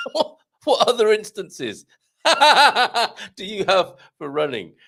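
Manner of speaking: cracking up mid-question; laughing. Real, not acted. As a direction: push the question out through real, high, loud laughter. Cracks up at own toilet joke.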